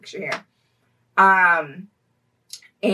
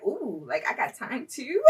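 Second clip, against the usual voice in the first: flirty and laughing; 4 semitones higher, 8 dB quieter than usual. Retold or imitated. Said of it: "And I was like".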